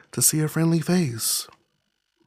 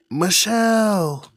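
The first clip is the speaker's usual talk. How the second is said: calling out; 5 semitones higher, 4 dB louder, much slower than usual. Acted. Someone calling her name.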